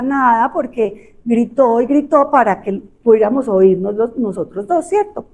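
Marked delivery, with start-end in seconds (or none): none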